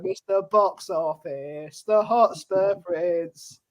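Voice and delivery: slow, grand announcing; slow proclamation. Retold or imitated. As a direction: roll it out slowly like a title. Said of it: "I thought he was going".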